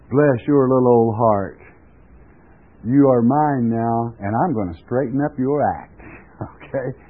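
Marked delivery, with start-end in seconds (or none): none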